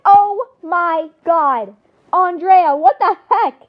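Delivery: loud, stretched-out shocked exclamation; shocked outburst. Acted. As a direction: exclaim it loudly, aghast at a student. Teacher's shocked outburst in told story.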